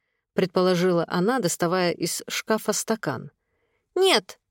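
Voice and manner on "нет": put-on very high toddler contradiction; high childish objection; objecting little boy. A narrator's reading. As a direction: pipe up in protest like a small child. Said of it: "возразил мальчик с пола".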